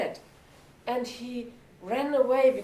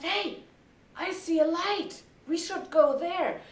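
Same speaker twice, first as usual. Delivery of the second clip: higher, eager discovery; excited rooster lookout; 6 semitones higher than usual. Acted. Rooster tells friends of a light, eager and raised. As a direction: announce your find from the treetop.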